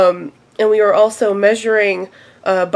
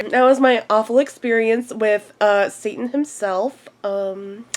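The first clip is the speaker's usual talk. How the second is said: ordinary talk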